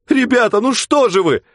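high, loud tearful plea; a girl close to crying; near tears plea. Acted. Girl begs the men, close to tears. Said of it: "чуть не плакала девушка".

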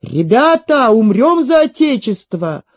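rousing cry building to a high shout; rally the men, climbing to a peak; battle cry. A narrator's reading. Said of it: "кричит". (боевой клич с нарастанием)

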